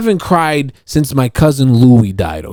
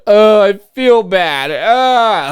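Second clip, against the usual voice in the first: high, slow mock whimper; hammed-up moping; mock self-pity; 10 semitones higher, 3 dB louder, much slower than usual. Mocking himself wallowing in feeling bad, mock whiny. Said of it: "waste these few moments on".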